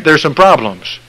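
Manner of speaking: loud, high-pitched pulpit preaching; full-force preaching. Acted. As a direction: drive it home to the congregation.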